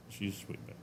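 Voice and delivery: breathy whisper to the dog; whispered coo. Real, not acted. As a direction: whisper sweetly to the pet. Cooing to the dog.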